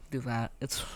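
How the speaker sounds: soft whispered aside. Real, not acted. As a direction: whisper it under the breath. Same urge, whispered then said aloud.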